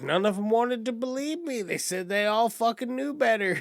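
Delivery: high, loud, hammed-up complaint; mock wailing protest. Imitating the bat lady excusing herself to the sheriff, mock wail.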